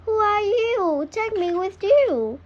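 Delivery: calling out. A narrator's reading. Duckling calls to the flying swans, high and loud. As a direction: call up to them, pleading. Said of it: "He calls to them".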